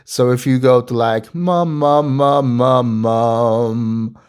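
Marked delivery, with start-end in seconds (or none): 1.3-4.2 humming mum in low speaking voice; easy, low humming syllables. Shown as a demonstration. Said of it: "I like to start really low in my speaking voice".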